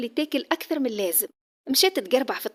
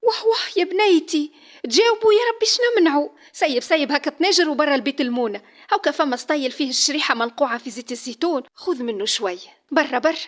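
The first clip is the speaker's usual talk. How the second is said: fussing mother; 5 semitones higher, 6 dB louder than usual. Acted. Mother exclaims, shoos daughter.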